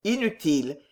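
ordinary delivery, nothing marked

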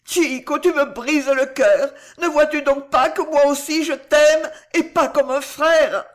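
high, loud, anguished plea; distraught Juana. A narrator's reading. Juana declares her love to the dying Chico, distraught and loud. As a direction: plead desperately through acted sobs. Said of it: "sanglota la petite Juana éperdue".

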